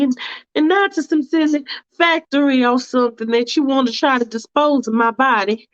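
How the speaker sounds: a fussy woman hedging politely; Faith's fussy voice. Acted.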